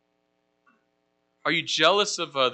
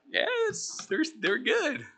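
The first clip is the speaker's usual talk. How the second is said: high, sheepish little yes; guilty kid caught with the candy; sheepish reply; 12 semitones higher, 4 dB quieter, much slower than usual. Pretend. Caught eating chocolates, answering his wife with sheepish guilt.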